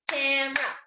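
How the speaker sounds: "Camera" as cam-ra in two beats; two syllables. Shown as a demonstration. Teacher drilling syllables with pupils, "Camera" as two-beat cam-ra. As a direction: drop the middle vowel of "Camera": cam-ra. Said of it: "It's actually two".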